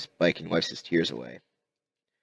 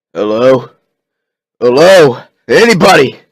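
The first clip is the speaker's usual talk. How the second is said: loud, rising calls into emptiness; calling out; 6 semitones higher, 14 dB louder than usual. Acted. Rick calling down the deserted hallway, loud. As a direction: call into the empty hall, louder each time.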